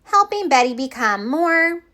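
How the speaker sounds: ordinary delivery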